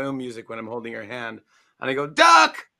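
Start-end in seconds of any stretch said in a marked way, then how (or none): 2.1-2.7 sudden very high, loud shout; shouting Duck. Retold or imitated. Author cueing kids at a reading, sudden high shout. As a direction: yell the cue word out.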